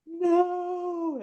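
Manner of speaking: high, soft squeaky donkey refusal; a balking donkey digging in; high little donkey no; stubborn donkey. Acted.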